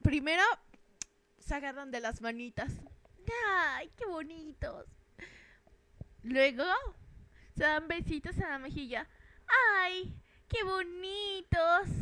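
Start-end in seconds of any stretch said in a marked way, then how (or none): 3.5-4.9 mock cooing. Cooing over couple, mock. 9.8-11.9 high, slow, drawn-out cooing; swooning coo. Pretend. Onlooker gushing at a couple holding hands, slow high coo.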